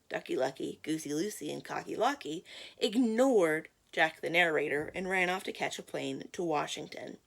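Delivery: ordinary delivery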